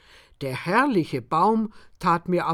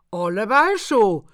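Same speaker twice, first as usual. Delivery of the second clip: higher, louder gloating; triumphant; 4 semitones higher, 7 dB louder than usual. A narrator's reading. Farmer gloats over the old enemy, high and loud. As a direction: crow it in triumph. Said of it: "triumphierte der Bauer".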